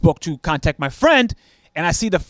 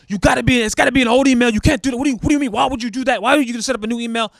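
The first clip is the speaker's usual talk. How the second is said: retold scammer's irritated protest, pitched up; mock upset scammer; 6 semitones higher, faster than usual. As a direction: play the scammer getting worked up and whiny. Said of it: "He then gets upset with me".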